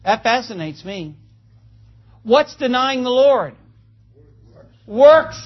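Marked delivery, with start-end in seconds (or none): 4.9-5.4 "Works" punched loud and high, long final s; stress on plural. Shown as a demonstration. Preacher answering his own question, "Works" stressed with a lingering s. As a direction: hit "Works" hard and sustain the s. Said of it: "Notice the plurification of the word work".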